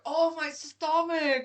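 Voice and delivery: slow, loud exaggerated moan; mock complaining. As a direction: imitate men whining, dragging it out. Mimics guys complaining after beer.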